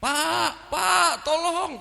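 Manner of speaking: high, drawn-out calling, acting a child; mock yell for help, pitched high; imitated cry for help. Son calls father from the well, imitated shouting. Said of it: "Dia teriak-teriak".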